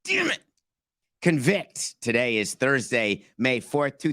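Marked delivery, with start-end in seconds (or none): 0.0-0.4 high, squeaky "damn it"; frustrated squeak. Real, not acted. Annoyed at flubbing the word, high squeak. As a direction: swear in a sudden high squeak. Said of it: "Can't believe I messed that up".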